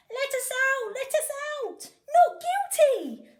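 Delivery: high, very loud pleading yells; robbers shouting. A narrator's reading. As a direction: demand release from the cell. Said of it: "they shout".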